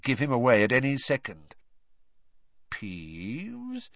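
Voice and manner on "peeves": soft doubt. A narrator's reading. Snape doubting Filch, very soft. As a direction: breathe the name with quiet suspicion. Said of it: "said Snape softly".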